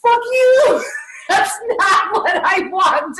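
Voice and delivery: wife yelling at husband over her diet, mock teary shout; mock angry outburst. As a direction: throw a hammed-up tantrum. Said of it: "And I'm like".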